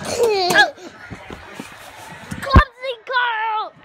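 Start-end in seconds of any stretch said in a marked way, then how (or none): 2.4-3.7 slow, high singsong taunt; teasing. Real, not acted. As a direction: drag out the teasing name.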